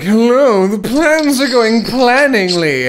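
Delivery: high, slow cartoon villain; hammy villain voice. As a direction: ham up a goofy evil henchman. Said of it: "he's just like".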